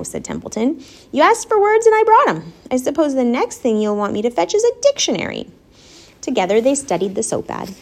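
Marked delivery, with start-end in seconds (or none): 1.1-5.5 high-pitched, huffy protest; indignant complaint. A narrator's reading. Rat fed up with fetching words, indignant.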